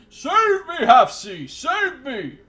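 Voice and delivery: very high, loud whiny pleading; bleating prince. Acted. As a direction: bleat for help like a coward.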